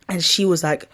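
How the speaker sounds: normal talking voice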